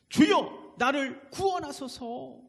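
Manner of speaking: 높게 내지른 뒤 느리게 끄는 간구; 베드로의 간구. Retold or imitated. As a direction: cry out to the Lord, slow and drawn out. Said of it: "베드로가 그렇게 얘기하고 있지". (높고 간절하게 부르짖듯)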